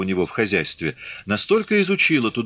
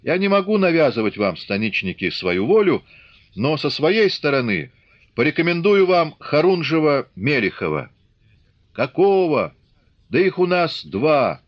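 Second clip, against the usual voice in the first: raised, carrying officer's address to the crowd; officer addressing the Cossacks; 6 semitones higher, 3 dB louder than usual. Acted. Officer speaking to the gathered Cossacks, raised and carrying. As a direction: speak up to the whole assembly.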